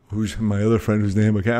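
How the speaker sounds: normal talking voice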